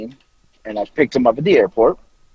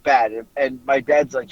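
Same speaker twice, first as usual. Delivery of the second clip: ordinary talk, nothing marked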